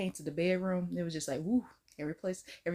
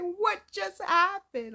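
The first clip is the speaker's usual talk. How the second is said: stunned; 9 semitones higher, 4 dB louder, much slower than usual. Retold or imitated. Acting stunned onlooker.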